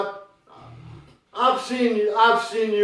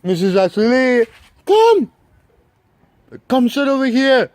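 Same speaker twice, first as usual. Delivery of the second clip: loud, raised call to a dog; insistent outdoor holler; calling out commands; 2 semitones higher, 6 dB louder than usual. Real, not acted. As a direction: summon the dog from a distance. Calling a dog over to sit.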